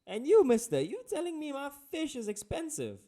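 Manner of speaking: high, loud, incredulous fisherman rant; hammed-up indignant squawk, climbing in pitch; sarcastic outrage. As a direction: throw it back at the customer, scandalized. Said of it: "a rather sarcastic sentence like".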